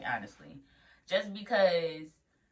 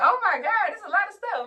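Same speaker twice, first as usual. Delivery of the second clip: pitched way up and louder, panicking; high overwhelmed squeal; 7 dB louder than usual. Retold or imitated. New nurse overwhelmed by all the tests, high squeal. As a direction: squeak out how much there is.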